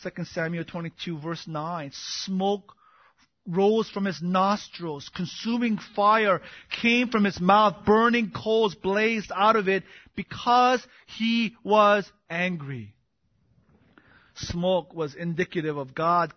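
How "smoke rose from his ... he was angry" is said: loud, slow, emphatic preaching; raised pulpit voice, drawn out; forceful scripture reading. Real, not acted. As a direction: declaim each phrase with pounding weight.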